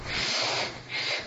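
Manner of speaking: very soft, secretive shushing; stealthy, breath-light warning; sneaky hushing. Acted. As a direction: hush them as if sneaking about at night. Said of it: "he will sneak at night".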